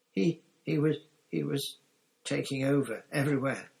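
high, stammering excuse; rasped gasp. A narrator's reading. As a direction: stumble into a desperate defence. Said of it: "rasped Pettigrew".

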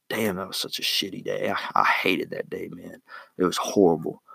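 quiet, dragging complaint that fades almost to nothing; gloomy sulk. Pretend. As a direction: grumble softly about a rotten day. Imagined person sulking about day.

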